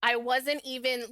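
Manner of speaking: normal talking voice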